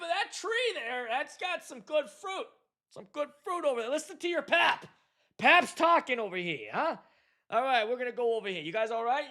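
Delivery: put-on high-pitched Jersey-accented grandpa on a boat. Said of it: "I got a Jersey accent".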